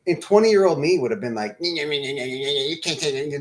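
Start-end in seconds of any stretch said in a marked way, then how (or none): 1.6-3.4 annoying mocking. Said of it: "Just some fucking annoying".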